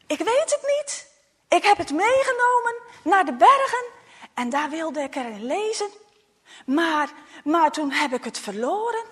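put-on a scared boy talking fast and high; nervous child lie. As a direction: blurt the excuse out in a panic. Tjo lies to his parents.

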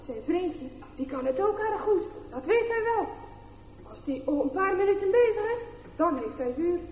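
plain reading voice

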